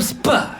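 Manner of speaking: "sp-" as soup without its vowel; vowels dropped. Acted. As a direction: pop "sp-" out with no oo. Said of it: "They take out the O and the U".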